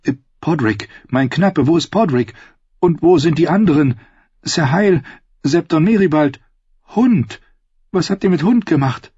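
high, anxious questioning; worried, high-pitched pleading; desperate fear. Acted. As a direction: ask after missing friends in rising panic. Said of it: "packte sie verzweifelte Angst".

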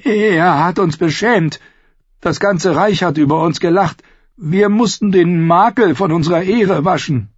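high, aggrieved justification; indignant captive. Acted. Captive justifies himself to the outlaws, indignant. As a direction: insist on the wounded family honour.

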